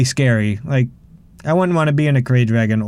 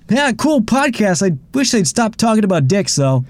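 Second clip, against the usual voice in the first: reviewer voice; 9 semitones higher than usual. Retold or imitated. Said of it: "they're gonna say".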